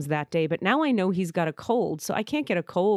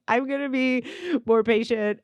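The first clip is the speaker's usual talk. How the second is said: higher, mock-tearful promise; 5 semitones higher than usual. Promising him patience after they both cried, imitated tearful. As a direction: promise it as if through tears. Retold tearful make-up call.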